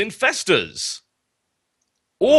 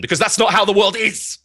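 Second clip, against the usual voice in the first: hammed-up despairing outburst, fast; melodramatic rant; 6 semitones higher, faster than usual. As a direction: overwrought exclamation. Mock despair rant on casting.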